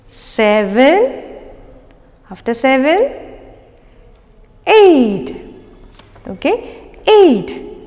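put-on drawn-out rising count for kids; singsong counting. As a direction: count along like a kids' teacher. Counting aloud for children.

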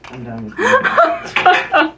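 high, slow laughing exasperation. Real, not acted. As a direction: give up through laughter.

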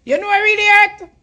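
pleading falsetto mock of a wounded lover; mock Aisha. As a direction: squeak it out like a lovesick woman moaning to her man. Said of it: "We tap use a voice, they can say it annoying".

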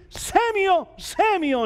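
high ringing call across the dark. Acted. God calling the boy Samuel, high and ringing. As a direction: call the boy's name from afar.

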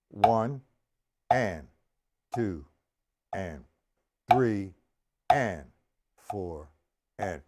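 evenly spaced count, a pause after each word; counting out beats. Shown as a demonstration. Drum teacher demonstrating the accents, measured one-word-per-beat count. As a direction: count slowly in time with the sticks. Counting out the whole line while playing.

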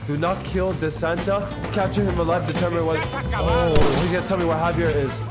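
ordinary talk

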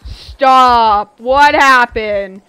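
loud, drawn-out disbelief; stunned and loud, slowly stretched; shocked outburst. Real, not acted. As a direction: cry out, stretching the words. Loud reaction to game event.

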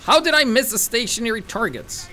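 loud, exasperated shout at himself; frustrated outburst. Real, not acted. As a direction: yell it in disbelief at missing. Frustrated at missed shots.

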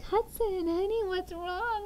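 concerned mom. Retold or imitated. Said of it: "I'm like".

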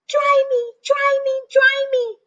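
a wet chick pleading with Jack; crying baby chick. Acted. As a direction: whine like a soaked chick. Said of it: "cried the soggy baby chick".

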